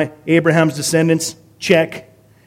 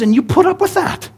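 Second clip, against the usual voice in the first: much higher, incredulous challenge; incredulous reproach; 12 semitones higher than usual. Acted. Paul to a church tolerating false teachers, incredulous reproach. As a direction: rise high in mock disbelief.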